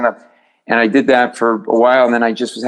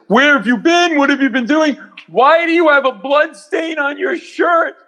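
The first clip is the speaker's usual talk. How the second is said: high, strained mock yell; imitating the senior partner's high yell; furious boss yelling. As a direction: rant at the late junior like an enraged dad. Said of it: "so mad he can't see straight when he's yelling".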